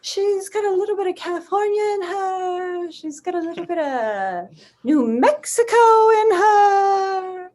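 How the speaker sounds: quirky woman voice. Shown as a demonstration. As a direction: savour each place like a character. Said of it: "she's kind of from all over the place".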